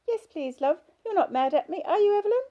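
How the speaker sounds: put-on high, loud little-girl voice from an old woman; childish voice. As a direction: play an old patient talking like a small child. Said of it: "The childish voice continued".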